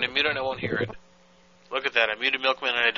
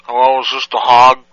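loud; 14 dB louder than usual. Acted. Prank call shouting on phone.

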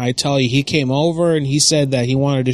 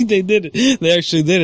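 laughing through the words; amused caller laughing at being put on hold; 7 semitones higher than usual. Real, not acted. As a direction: giggle it out in disbelief.

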